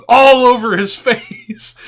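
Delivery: loud, high and stretched through laughter; real laughter bursting into the words; laughing. Real, not acted. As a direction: crack up on the punchline. Teller cracks up at punchline.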